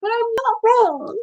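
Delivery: pitched far up, stubborn; insistent protest. Real, not acted. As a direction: squeak it out defiantly. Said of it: "He needs anger management training".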